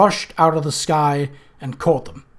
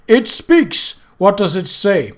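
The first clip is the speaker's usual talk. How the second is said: loud, high mock astonishment; mocking surprise; 6 semitones higher, 6 dB louder, slower than usual. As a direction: sneer with fake wonder.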